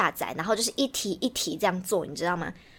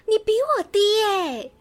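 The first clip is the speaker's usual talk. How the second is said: high, slow gasp of gleeful disbelief; gasping surprise; 12 semitones higher, 5 dB louder, slower than usual. Real, not acted. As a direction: stretch it out, delighted to catch her out. Teasing friend about her test score.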